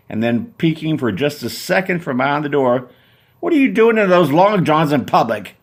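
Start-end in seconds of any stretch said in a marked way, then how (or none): 3.4-5.6 high, loud indignant woman; Susan indignant. Acted. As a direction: scold him from behind the door. Susan mocking his costume.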